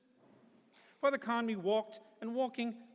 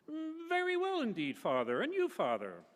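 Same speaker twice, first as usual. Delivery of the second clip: genteel lady's reply; 10 semitones higher than usual. Acted. Priest greets Mrs Sheehy.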